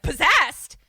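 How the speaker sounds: loud, high shocked question; mock-horrified outburst; alarmed echo. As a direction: blurt it back in alarm. Retold alarmed reaction to word.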